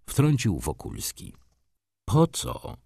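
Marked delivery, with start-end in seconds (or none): 2.0-2.8 startled. Acted. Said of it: "skoczył na fotelu adwokat".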